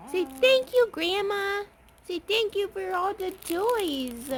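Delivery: high, cooing prompt. Real, not acted. Speaking for baby to grandma.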